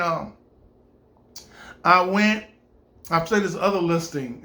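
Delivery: ordinary talk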